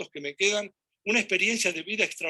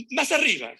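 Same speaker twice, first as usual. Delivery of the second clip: insistent, raised-pitch coaxing; retold urging; 5 semitones higher, 4 dB louder than usual. Host urges reluctant José to cut higher, insistent. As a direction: push him on, acting it out.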